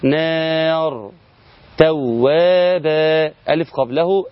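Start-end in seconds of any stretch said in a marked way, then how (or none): 0.0-1.1 نار with the alef held long and slow; stretched vowel. Shown as a demonstration. Teacher reciting an example of alef madd, stretched. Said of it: "قال". 1.8-3.3 توابا with the long alef drawn out slowly; stretched vowel. Shown as a demonstration. Teacher reciting an example of alef madd, stretched. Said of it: "قال".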